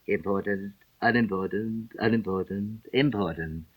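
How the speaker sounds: murmured low and soft to himself; soft, low trial of each word; undertone. A narrator's reading. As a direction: try out each word under his breath. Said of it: "went on to himself in an undertone".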